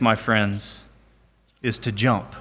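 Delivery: preacher hushing for the punchline; quiet emphasis. Real, not acted. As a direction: drop the voice to land it. Quiet drop after climax.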